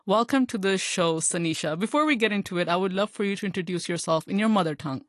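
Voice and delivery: ordinary delivery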